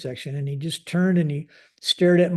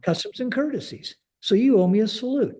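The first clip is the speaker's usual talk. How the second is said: higher, emphatic demand; insistent reprimand; 7 semitones higher than usual. Retold or imitated. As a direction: lay down the rule to a subordinate, retold. Commander lectures airman on saluting.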